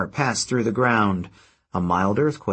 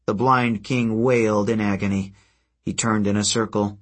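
usual speaking voice

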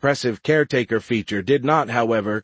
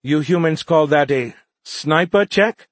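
higher, louder questioning alien voice; young alien liaison; 7 semitones higher, 6 dB louder than usual. A narrator's reading. Alien asking humans about their term, earnest young voice.

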